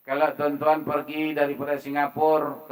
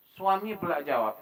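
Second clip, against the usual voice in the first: usual speaking voice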